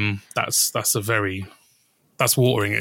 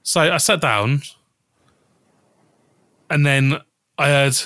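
usual speaking voice

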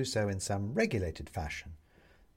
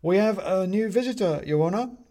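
high, loud address to the judge; little china man; 9 semitones higher, 6 dB louder than usual. Acted. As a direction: present the visitor to the court. Bobs presents visitor to judge.

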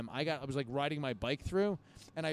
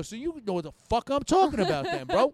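loud, higher, combative comeback; retold angry retort; 9 semitones higher, 9 dB louder than usual. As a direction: replay getting mad at the cops. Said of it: "I was getting mad".

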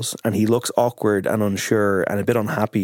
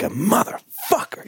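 mock yell; 12 semitones higher, slower than usual. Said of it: "look into the camera and let out".

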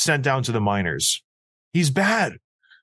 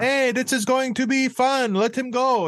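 much higher, giddy French-Canadian voice; mock French-Canadian accent; 10 semitones higher than usual. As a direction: imitate Fleury excited for a fun fight. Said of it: "coming in with that sweet accent".

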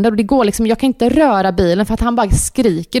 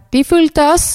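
higher, with a laugh; chirpy stock reply; 6 semitones higher than usual. Retold or imitated. Mum quoting her usual answer to friends, chirpy and laughing. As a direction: toss off the standard answer, half laughing.

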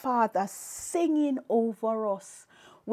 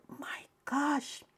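breath-only, stunned whisper; awed whisper; 3 semitones higher, 7 dB quieter than usual. Retold or imitated. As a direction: whisper it in retold amazement. Retold awe at hearing the lyrics.

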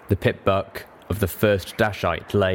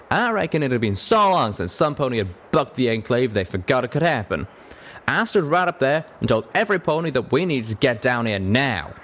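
higher-pitched folksy Western character; drawling cowpony; 5 semitones higher than usual. Acted. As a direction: tell your story as a plain-spoken cowpony.

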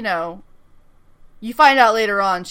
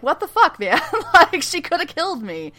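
retold outrage; 5 semitones higher, 3 dB louder than usual. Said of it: "Ando's real pissed".

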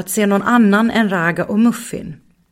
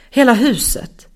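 usual speaking voice

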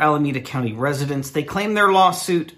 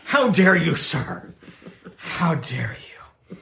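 mock outrage; slower than usual. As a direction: play the offended spokesman. Quoting Subway statement with mock outrage.

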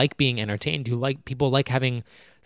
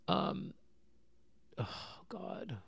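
a broken near-silent whisper; whispered oh God; 6 semitones higher, 18 dB quieter, much slower than usual. Real, not acted. Vlogger breaking down to viewers, whispering. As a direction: breathe the words out with no voice.

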